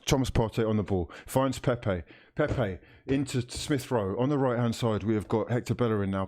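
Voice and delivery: TV match commentator voice; live commentary. Acted. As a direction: call the match like a broadcaster. Commentary resumes.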